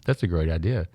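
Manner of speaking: normal talking voice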